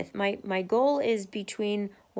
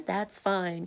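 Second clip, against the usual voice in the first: ordinary talk, nothing marked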